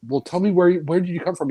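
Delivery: movie character's question. Acted. Asking Gabriel Byrne's character where he's from, lightly acted. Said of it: "and they said".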